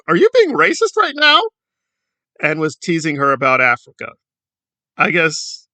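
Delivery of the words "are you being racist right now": indignant. Retold or imitated. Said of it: "And Jessica's like".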